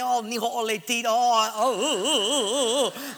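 mock gloating commander's laugh; villainous laugh. Imitating a Russian commander closing in, mock villain laugh. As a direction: draw out a hammed-up wobbling villain laugh. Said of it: "and he's laughing".

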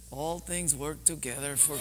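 put-on soft German-accented voice. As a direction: gently imitate a frail woman's German accent. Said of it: "she said in her German accent".

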